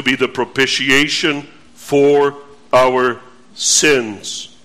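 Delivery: low, measured reading of the Bible verse; scripture reading. Acted. Pastor reading scripture to the congregation, solemn and measured.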